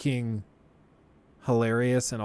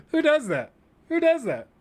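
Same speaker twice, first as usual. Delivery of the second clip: incredulous. Real, not acted. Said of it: "He's a hilarious, hilarious person".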